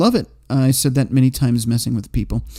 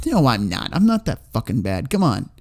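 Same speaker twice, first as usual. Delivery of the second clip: usual speaking voice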